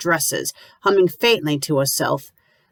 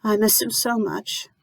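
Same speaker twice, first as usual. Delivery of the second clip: soft trembling grief, softer; 5 semitones higher, 4 dB quieter than usual. A narrator's reading. Lucy grieving her husband to the landlady, trembling and soft. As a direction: let the words shake as she grieves. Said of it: "her voice trembling".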